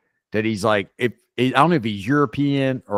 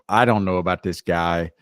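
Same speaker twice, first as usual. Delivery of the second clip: ordinary delivery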